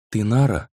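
«Ты Нара?» half asked, half stated; unsure recognition on «Ты Нара?»; half-questioning. A narrator's reading. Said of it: "произнёс он полувопросительно".

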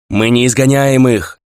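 slow, loud declaration over noise; commanding shout. A narrator's reading. Leader over wingbeats and roaring, commanding shout. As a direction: project it, stretching the words. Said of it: "Голос Дихира заглушил шум крыльев".

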